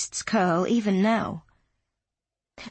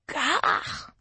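exasperated gah; 7 semitones higher than usual. Acted. Exasperated outburst.

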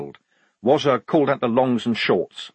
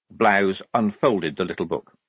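ordinary delivery, nothing marked

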